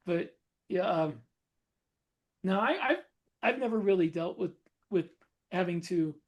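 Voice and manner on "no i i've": ordinary talk, nothing marked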